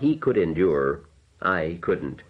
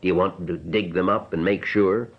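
plain reading voice

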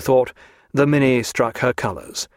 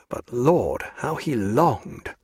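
ordinary delivery, nothing marked

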